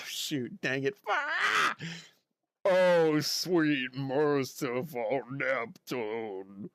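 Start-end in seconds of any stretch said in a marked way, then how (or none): 1.1-1.8 excited yelp. Real, not acted. Excited yelp before impression. 2.6-6.7 slow, drawn-out fretful teacher voice; Mrs Puff impression. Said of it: "Can you do a Missus Puff".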